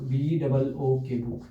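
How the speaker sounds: teacher slowly spelling a word for students. Shown as a demonstration. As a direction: spell "बी डबल ओ" letter by letter, slow. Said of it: "जैसे मैंने बोला बच्चों book".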